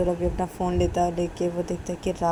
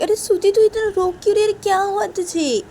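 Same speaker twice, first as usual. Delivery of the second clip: much higher, louder alarmed voice; alarmed friend; 14 semitones higher, 6 dB louder than usual. Acted. Vikas asks crying Shruti why she weeps, high and alarmed. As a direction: call out in shock at her tears. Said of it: "विकास बोलता है श्रुति को".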